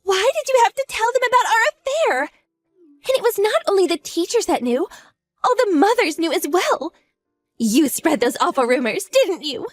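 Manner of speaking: humiliated fury; outraged accusation. Acted. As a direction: demand why she told everyone. Outraged over spread affair.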